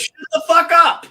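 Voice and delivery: hammed-up yell, high and loud; mock outburst. Cutting in on a friend's doctor story, hammed-up shouted comeback.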